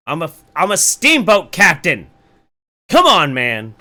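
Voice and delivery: loud excited shout; playful shout. Real, not acted. Playful shout about his look.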